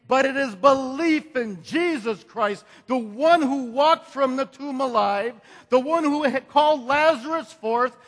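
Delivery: preaching at full force. Acted. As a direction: drive the congregation toward the climax. Preaching climax.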